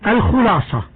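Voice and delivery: usual speaking voice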